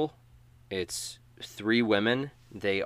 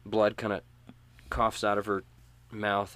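ordinary delivery

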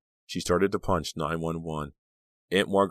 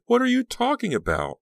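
very high, loud incredulous cry; cried out; 5 dB louder than usual. A narrator's reading. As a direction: cry it out in alarm. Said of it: "Danielle cried".

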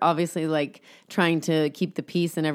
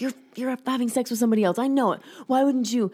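mock accusing husband, higher and quick; jealous husband; 6 semitones higher, faster than usual. Husband accusing his wife of cheating, higher put-on voice.

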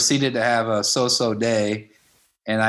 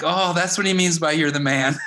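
realizing; 7 semitones higher than usual. Retold or imitated. Retold realization.

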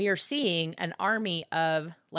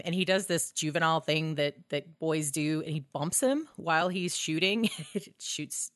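ordinary talk, nothing marked

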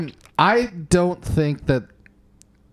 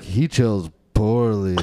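grave knight voice; 7 semitones lower, much slower than usual. Retold or imitated. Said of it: "the Knight Templar's like".

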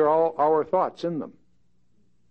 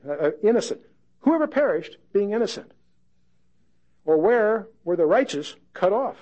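raised, swelling preacher's reading; scripture reading; 4 semitones higher, 3 dB louder than usual. Acted. Pastor reading Eliphaz's speech to the congregation, raised and proclaiming. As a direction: proclaim the old words with rising force.

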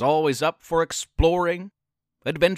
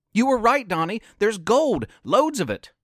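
ordinary delivery, nothing marked

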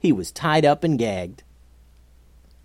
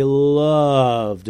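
loved stretched out long; stress on loved; 4 dB louder than usual. A narrator's reading. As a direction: draw out the word loved with relish. Narrator dwells on how much.

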